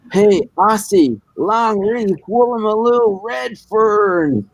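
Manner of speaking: high, drawn-out Texan holler; cartoon Texan. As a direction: call out across the street as a mock Texan, each word stretched. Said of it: "a real Texan type thing, imagine in, in cartoons".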